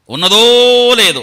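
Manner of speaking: ఉన్నదో stretched long, loud and high, then falling; high, booming stretch on ఉన్నదో; drawn-out emphasis. Real, not acted. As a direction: proclaim it slowly, swelling hugely on ఉన్నదో. Preacher hammers a verse phrase.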